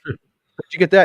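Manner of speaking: high, loud curious question. Retold or imitated. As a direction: ask it intrigued and eager. Retelling chat with the cop.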